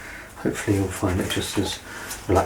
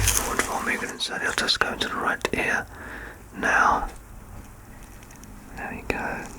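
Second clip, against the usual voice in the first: soft, close whispered ear exam; ASMR whisper; 7 dB quieter than usual. Acted. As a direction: whisper gently as you check the ear.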